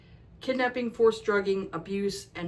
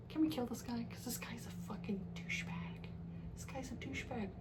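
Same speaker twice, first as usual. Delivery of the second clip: softer, then a real whisper; conspiratorial whisper; 3 semitones higher, 14 dB quieter than usual. Retold or imitated. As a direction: whisper it like a shared secret. Conspiratorial rant about the villain.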